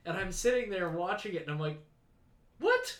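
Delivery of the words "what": very high, rising mock astonishment; baffled disbelief. Viewer watching a decades-old episode, baffled disbelief. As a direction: leap up into an incredulous question. Acts out own baffled reaction.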